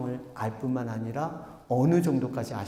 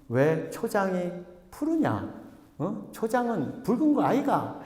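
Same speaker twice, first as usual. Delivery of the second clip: puzzled Gyeongsang dialect, pitched up; Gyeongsang student; 6 semitones higher than usual. Acted. Gyeongsang student questioning the teacher, puzzled Gyeongsang dialect. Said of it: "경상도니까 경상도 학생들이 뭐라 그래요?".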